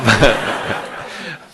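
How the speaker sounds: hearty real laughter. Real, not acted. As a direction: burst of helpless laughter. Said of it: "I almost couldn't stop laughing".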